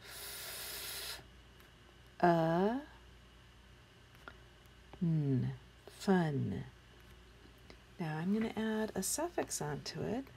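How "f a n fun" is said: long "F", short "a", held "n", then "fun" blended; sounding out. Shown as a demonstration. Teacher sounding out one syllable, "F", "a", "n" apart, then "fun". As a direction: say each sound alone with pauses, then "fun" whole. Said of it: "Fun is one syllable".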